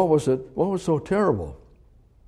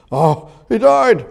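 mock shock; 8 semitones higher, 6 dB louder, slower than usual.

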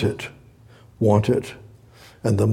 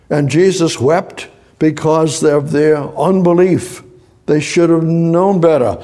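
raised, higher preaching voice; impassioned preaching; 4 semitones higher, 5 dB louder than usual. Real, not acted. Preacher lamenting to the congregation that they never use God's help, impassioned preaching. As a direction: drive the lament home with force. Preaches forcefully about unbelief.

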